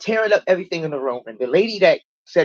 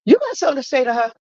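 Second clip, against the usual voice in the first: imitated counselor's challenge, high and quick; confronting; 7 semitones higher, faster than usual. Counselor stops her group to call her out, imitated challenge.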